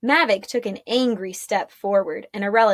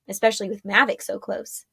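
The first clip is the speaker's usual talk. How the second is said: plain reading voice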